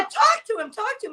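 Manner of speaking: urging mother. Retold or imitated. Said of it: "So she said".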